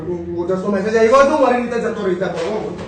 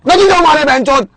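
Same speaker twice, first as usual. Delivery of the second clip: fed-up shout; 7 semitones higher than usual. Real, not acted. Snapping at friends nagging him about the bathroom, shouting. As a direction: yell your refusal and let it wind down.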